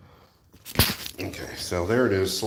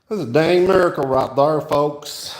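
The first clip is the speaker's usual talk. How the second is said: put-on playful country accent, higher and louder; 5 semitones higher, 5 dB louder than usual. Builder jokes to viewers about progress, mock country accent. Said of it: "He said in his country accent".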